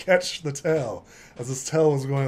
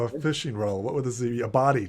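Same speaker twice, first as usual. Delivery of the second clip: ordinary talk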